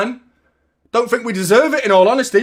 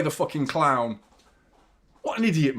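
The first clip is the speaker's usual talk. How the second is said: normal talking voice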